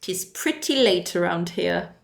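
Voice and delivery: put-on slow, playful British accent. Streamer jokes about how late it is, British accent. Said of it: "I don't know why I did that accent".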